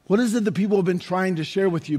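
usual speaking voice

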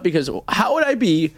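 ordinary talk

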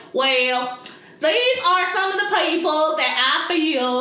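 mock thick Southern accent; exaggerated Southern accent. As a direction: drag it out in an overdone Southern drawl. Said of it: "those people over-exaggerate".

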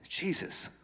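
normal talking voice

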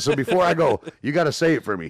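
high, loud eager plea; excited. Retold or imitated. Fan urging the actor to say his catchphrase, excited. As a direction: beg like a thrilled fan.

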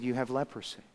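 low, hushed verdict; grave pronouncement. Acted. Priest to the sick man, quietly breaking bad news. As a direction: deliver it softly and gravely.

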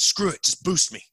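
loud, reckless call to action; imitating a buddy egging everyone on; gung-ho resolve. Buddy's bold decision at fence.

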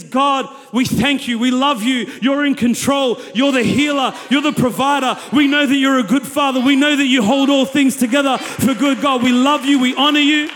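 fervent, loud thanksgiving to God; loud praise prayer. Acted. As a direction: pray it out with conviction. Voiced praise prayer under pressure.

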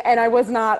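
ordinary talk, nothing marked

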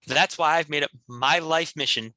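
usual speaking voice

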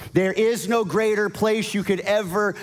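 high, building preacher's cry; preaching at full force. Acted. Preaching climax, rising intensity.

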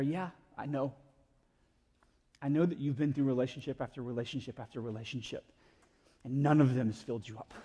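low, soft reassurance; acted gentle knowing. Jesus telling the woman he knows her past, gentle knowing. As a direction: say it tenderly, with quiet understanding.

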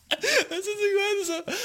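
words squeezed out through laughter; laughing while talking. Real, not acted. Telling a friend the funny story, laughing through words. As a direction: try to talk while cracking up.

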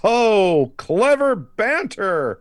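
high, loud, drawn-out sarcastic gushing; mock delight. Co-host mocking the film's dialogue, hammed-up delight. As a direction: exclaim it in exaggerated fake admiration.

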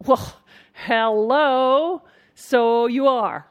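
loud, high, very drawn-out mock obviousness; exaggerated sing-song sarcasm; sarcastic. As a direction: stretch each word as if stating the obvious. Mocking reply to self-labelling.

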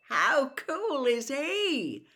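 high, slow, starry-eyed; excited gush. Acted. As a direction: gush like a fan. Twin gushing about Moody.

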